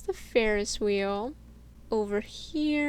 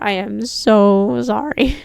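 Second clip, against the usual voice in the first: slow, louder, heartfelt sorry; sincere apology; 12 dB louder, slower than usual. Real, not acted. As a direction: say it like you truly mean the apology. Apologizing to forgotten viewer.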